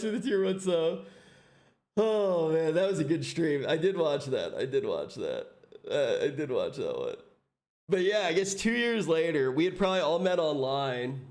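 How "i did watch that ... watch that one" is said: mock deep voice. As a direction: drop the voice way down for a gag. Repeated in a dropped voice.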